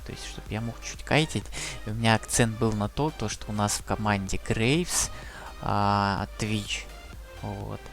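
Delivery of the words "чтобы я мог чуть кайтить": ordinary delivery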